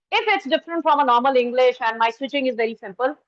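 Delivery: normal talking voice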